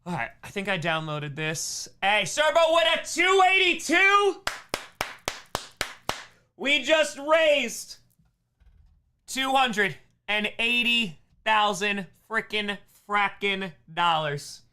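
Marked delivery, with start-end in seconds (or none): 2.0-4.4 much higher, loud hype shout; excited shout. Real, not acted. Donation milestone hype. 6.6-14.6 slow, loud proclaiming; triumphant announcement. Real, not acted. Announces fundraising milestone.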